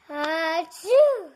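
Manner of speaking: big achoo; loud sneeze. Acted. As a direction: sneeze out hard.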